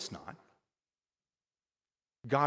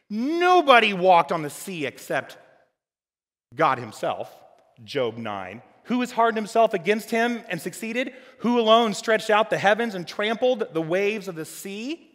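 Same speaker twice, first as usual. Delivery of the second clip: louder, proclaiming reading of Job; scripture proclamation; 8 semitones higher, 8 dB louder than usual. Acted. Preacher to congregation reading Job, loud proclamation. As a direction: proclaim the verses boldly.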